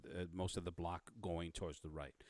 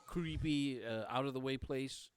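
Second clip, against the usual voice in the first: incredulous recap; 5 semitones higher, 3 dB louder than usual. Acted. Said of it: "So she said".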